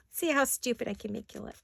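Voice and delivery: high, teasing taunt with giggles; mocking body. Body taunting its owner on camera, high playful mockery. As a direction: play your own body gleefully making fun of you. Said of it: "It's your body's way of going".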